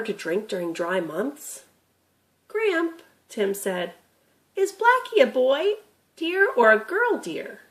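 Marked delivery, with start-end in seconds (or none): 2.5-3.0 put-on lightly raised, curious boy; a young grandson asking, a bit higher; boy Tim's voice. Grandson asking Gramp about the deer, curious boy voice. 4.5-7.6 put-on lightly raised, curious boy; a young grandson asking, a bit higher; boy Tim's voice. Grandson asking Gramp about the deer, curious boy voice.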